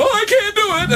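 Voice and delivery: very high, whiny backing out; mock coward. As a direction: whimper it like a coward.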